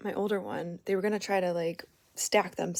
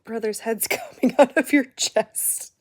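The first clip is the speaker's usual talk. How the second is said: higher, louder, laughing through it; laughing reply; 4 semitones higher, 8 dB louder than usual. Retold or imitated. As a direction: crack up while you tell them. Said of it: "I said".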